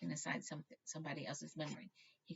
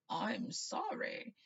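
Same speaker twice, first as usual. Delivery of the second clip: slow, higher nervous sorry; shaky apology; 6 semitones higher, 5 dB louder, much slower than usual. Acted. Harry intruding on a stranger, shaky and slow. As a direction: apologise with a tremble, slowly. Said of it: "he said shakily".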